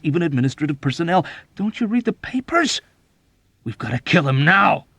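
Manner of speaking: agitated argument swelling loud and high; overwrought, insistent tirade; furious urgency. A narrator's reading. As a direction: build to a frantic demand. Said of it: "Dobbs roared furiously back".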